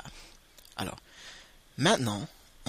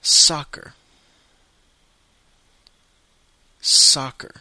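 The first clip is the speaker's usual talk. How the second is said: soccer, louder and higher, SAH-ker; American English; 3 semitones higher, 11 dB louder, slower than usual. Shown as a demonstration. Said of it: "Aux États-Unis, on dit".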